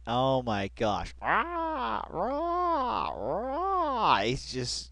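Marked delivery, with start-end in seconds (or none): 1.2-4.2 high yelped "rah" imitating screams; lightly mimicked screaming; mock screams. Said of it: "just, just screaming".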